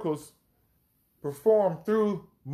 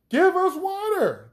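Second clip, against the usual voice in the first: high-pitched mock wail; 13 semitones higher, 4 dB louder than usual. Thirsty people demand water from Moses, wailing. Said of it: "they wailed".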